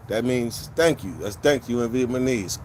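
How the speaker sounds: ordinary delivery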